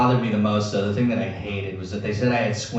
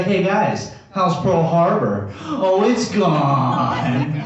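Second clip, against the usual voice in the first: higher, slow sing-song jeer; mocking taunt; 7 semitones higher, 4 dB louder, much slower than usual. Zach hitting back at the bullies, drawn-out mocking jeer. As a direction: drag out the jab at the bullies. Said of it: "I'd be like".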